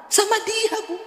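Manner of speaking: high, soft widow voice; a timid high reply; imitating the widow. Preacher voicing the widow naming her dead husband, high and soft. Widow says who she resents.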